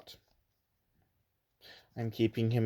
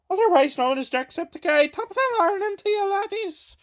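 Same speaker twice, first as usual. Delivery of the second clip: high, hammed-up Irish accent, sing-song; stage-Irish greeting like a gamer, pitched way up; 4 dB louder than usual. Showing off his Irish accent to viewers, high and hammy. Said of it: "I can do an Irish accent".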